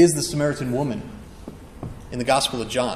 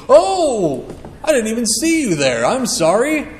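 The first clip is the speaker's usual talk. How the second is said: fake surprise; 6 semitones higher, 5 dB louder than usual. Feigning surprise to avoided acquaintance.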